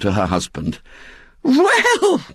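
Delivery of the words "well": very high, drawn exclamation of wonder; amazed. A narrator's reading. Missus Bott turns to her husband, amazed. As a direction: squeal it in disbelief.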